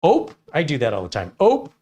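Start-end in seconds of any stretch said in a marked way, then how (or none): 0.0-0.3 high, loud little "ope!"; startled, clipped-off oops sound; polite Midwest "ope". Retold or imitated. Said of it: "they're very polite". 1.4-1.7 high, loud little "ope!"; startled, clipped-off oops sound; polite Midwest "ope". Retold or imitated. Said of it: "It means oops".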